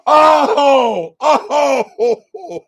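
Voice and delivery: amused, high wail; laughing outburst. Real, not acted. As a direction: howl each "oh" and fall into laughing. Host cracking up at chat jokes.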